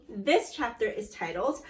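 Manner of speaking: ordinary talk, nothing marked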